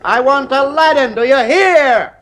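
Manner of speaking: high, slow, desperate shouting at the machine; frantic demand. Acted. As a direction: plead and command at once, voice climbing. Husband demanding the room change.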